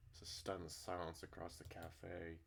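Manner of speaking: normal talking voice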